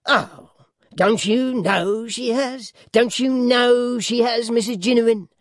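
high, slow, stretched-out taunt; taunting dwarf. A narrator's reading. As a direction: drag out each question to goad her. Voices dwarf Quilp in reading.

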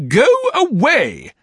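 put-on high, loud, slow shout of a fierce old lady; stern old aunt. A narrator's reading. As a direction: drive the boy off with a shrill bark. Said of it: "said Miss Betsy".

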